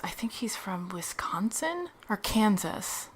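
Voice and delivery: slow confiding whisper. Real, not acted. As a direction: drop to a secretive whisper. Gossipy aside about the carpenter.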